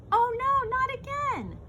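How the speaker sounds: high, loud oh no; dismayed cry. Acted. Bean dismayed as lunch hits his loafers. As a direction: groan of dismay at spilled lunch.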